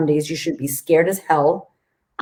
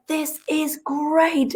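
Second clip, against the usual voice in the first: slow high delight; 11 semitones higher, 4 dB quieter, much slower than usual. Retold or imitated. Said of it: "I'm like".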